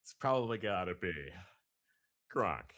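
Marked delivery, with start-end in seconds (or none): 2.3-2.7 loud, falling shout of a name; go-to Gronk impression. Shown as a demonstration. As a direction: belt out one big loud syllable. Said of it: "your go-to voice impression".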